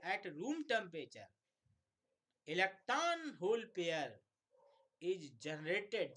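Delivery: At room temperature said slowly, word by word; slow dictation. Shown as a demonstration. Teacher dictating the exact answer sentence. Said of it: "generated word इस्तेमाल करें".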